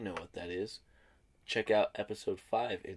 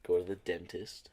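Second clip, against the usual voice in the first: ordinary talk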